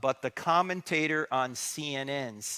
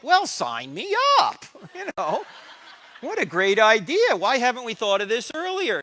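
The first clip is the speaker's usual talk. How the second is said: sarcastic enthusiasm; 8 semitones higher, 9 dB louder than usual. Pretend. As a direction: fake delight at a terrible deal. Mock apostle sarcasm.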